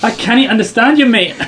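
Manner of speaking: loud, high-pitched mock Scottish accent. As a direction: shout back in an exaggerated Scottish accent.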